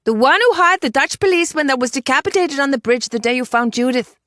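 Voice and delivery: high-pitched exasperated explanation; exasperated woman reminding a companion. A narrator's reading. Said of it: "breathed an exasperated sigh".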